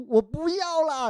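whiny refusal. Retold or imitated. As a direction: whine it like a shy girlfriend. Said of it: "只好就是回答说".

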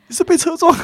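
high, loud, panicked mother's question; imitating shocked mom. Mom at the door seeing her daughter's bloody face, high panicked question. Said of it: "我妈就".

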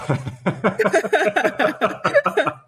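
high-pitched burst of laughter; real helpless high laugh; laughing fit. Real, not acted. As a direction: crack up in a long high giggle. Laughing over asking to bleep that bit.

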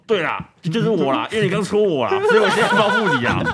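taunting brother's voice, rising sharply high at the end with a laugh; 嘲弄地学哥哥挑衅, 尾句拔高带笑; mocking taunt. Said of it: "他就跟我说". (学哥哥挑衅，笑着拔高音调)

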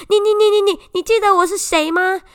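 imitating a boy's delighted stammer; happy stammer. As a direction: trip over the words from sheer excitement. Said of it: "还很开心说".

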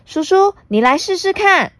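put-on high, bright little girl. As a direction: chatter like a cheeky young girl. Puna speaks.

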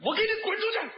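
high shaking shout of rage; trembling furious yell. Retold or imitated. Furious at the director, shaking with rage. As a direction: point and scream it out, quivering. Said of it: "拿手一指着，我都哆嗦了".

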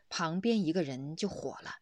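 ordinary talk, nothing marked

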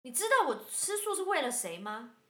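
sister's angry retort. Retold or imitated. Sister snapping back slow and loud at her sibling. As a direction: demand it slowly and loudly. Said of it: "继续".

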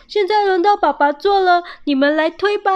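loud, high-pitched call; loud announcing. A narrator's reading. Squirrel dad claiming his turn on the sled, loud. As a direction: announce it loudly and eagerly. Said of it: "爸爸大声说".

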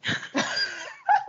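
high-pitched burst of laughter. Real, not acted. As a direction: let out a shrill cackle. Big laugh.

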